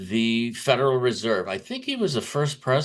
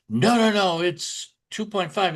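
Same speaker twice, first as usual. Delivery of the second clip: pitched up high, hurried no-no-no of a caught man; mock flustered denial, high and rushed; flustered Powell; 8 semitones higher than usual. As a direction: imitate someone flustered and scrambling to deny it. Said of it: "getting ready to start stammering".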